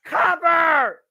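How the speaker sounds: high, loud urgent call; shouting. Real, not acted. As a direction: yell for the pin. Urging the pin.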